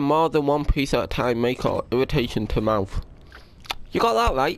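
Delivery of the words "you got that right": high sarcastic retort. Real, not acted. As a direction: shoot back sarcastically, high. Sarcastic reply to warning label.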